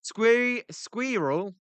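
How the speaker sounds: mock the odd "squirrel" with a long ee. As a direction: quizzical "squirrel?" with the ee stretched. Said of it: "It ain't squirrel".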